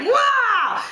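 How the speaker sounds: high, drawn-out mock yell; Hulk-style roar. Venting wish to flip the table, mock Hulk yell. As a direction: let out a playful "wah" of rage. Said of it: "turn into the Hulk".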